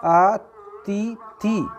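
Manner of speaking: अतिथि stretched very slowly, each syllable clear; drawn-out model word. Shown as a demonstration. Teacher modelling the correct word for students, very slow and drawn out. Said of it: "अतिथि कैसे लिखा आता है शुद्ध".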